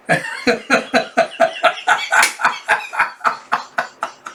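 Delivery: wheezing, breathy giggle fit; high voiceless helpless laugh; real laughter. Real, not acted. Laughing at child's confusion, real wheezy laughter.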